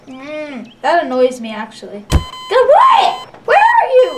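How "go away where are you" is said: high, loud exasperated shout; frustrated yell. Real, not acted. Yelling at a hidden teammate, frustrated shout. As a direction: yell it out, fed up and searching.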